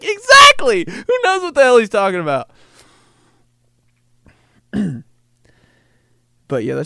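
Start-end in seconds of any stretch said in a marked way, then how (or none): 0.0-2.5 excited outburst. Retold or imitated. Kid cheering at TV.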